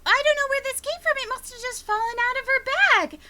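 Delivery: put-on high, quick innocent child; innocent kid denial. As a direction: play dumb, very sweet. Child denies theft in dialogue.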